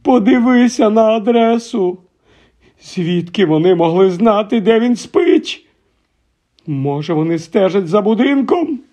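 high anxious tremble; trembling voice. Acted. Aunt fretting to husband about the address, trembling. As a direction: worry aloud, voice quivering. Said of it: "тремтячим голосом".

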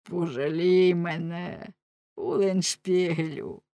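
slow, drawn-out werewolf plea; trapped werewolf imploring slowly; pleading werewolf. A narrator's reading. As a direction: beg for pity, stretching the words. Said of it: "Раптом вовкулак заговорив".

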